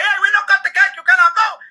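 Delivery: high-pitched, loud put-on host voice; exclaiming host in a put-on voice; urgent party host. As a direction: stop a departing guest, loud and high. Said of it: "The host of the party runs to me and says".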